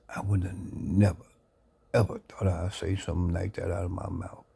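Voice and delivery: ordinary delivery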